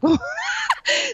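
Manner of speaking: a laughing shriek, very high; big shrieking laugh. Real, not acted. Guest cracking up at her own story, laughing shriek. After mocking coworker's beer smell.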